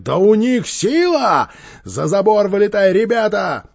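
loud, high cry to retreat; panicked yelling. A narrator's reading. Gang leader to his boys in retreat, yelling. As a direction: holler for everyone to flee. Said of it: "заорал Квакин".

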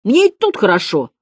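cheeky pupil's exaggerated objection; wry defiance. A narrator's reading. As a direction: refuse the teacher in a high, loud voice. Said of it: "скривился Градусов".

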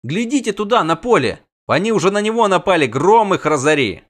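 high, loud and fast; alarmed shout. Acted. Prisoner points at the attack, shouting high and fast. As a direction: yell the warning out fast.

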